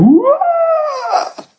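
very high, drawn-out mock wail. Preacher imitating wife as he crawls to bedroom, high wail. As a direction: imitate her wailing out, way up high. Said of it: "she went".

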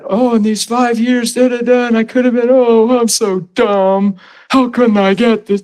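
mock self-reproach. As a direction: act out moaning over your own stupidity. Acted self-reproach over wasted years.

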